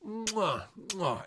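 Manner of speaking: kissing sounds praising Cage; chef's kiss. Real, not acted. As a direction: blow a kiss of approval. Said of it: "blowing kisses at the film".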